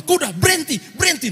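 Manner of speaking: high, loud frantic stop; panicked yell. Retold or imitated. Pastor screams at his runaway horse in panic.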